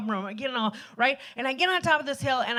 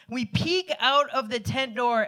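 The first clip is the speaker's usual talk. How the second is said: ordinary talk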